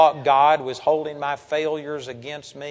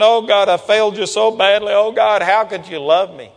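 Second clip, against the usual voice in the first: loud, raised mock lament to God; anguished pleading; 3 semitones higher, 5 dB louder than usual. Believer pleading before the Lord after failing, loud acted anguish. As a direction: wail it out like a guilt-ridden believer, half acted. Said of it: "come before the Lord saying".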